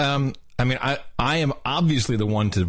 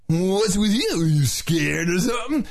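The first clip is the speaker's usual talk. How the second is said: high taunting surfer dude; pitched-up surfer taunt; 7 semitones higher, slower than usual. Shown as a demonstration. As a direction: jeer like a surfer kid. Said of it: "the whole thing in a surfer's voice".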